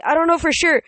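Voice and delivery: high-pitched, loud protest; desperate. A narrator's reading. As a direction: insist in desperation. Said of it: "I said desperately".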